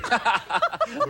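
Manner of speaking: host's amused laugh; real laugh. Real, not acted. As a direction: burst out laughing at the mix-up. Host amused by pointing mix-up.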